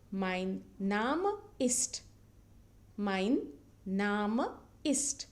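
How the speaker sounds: mein Name ist stretched word by word; slow drilled pronunciation. Shown as a demonstration. Teacher repeating mein Name ist slowly for learners. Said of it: "But the pronunciation is different".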